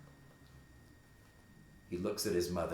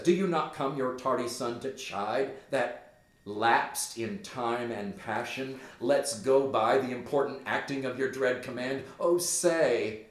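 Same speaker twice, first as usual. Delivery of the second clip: Hamlet pleading; 4 semitones higher, 6 dB louder than usual. Acted. Hamlet addresses the ghost again.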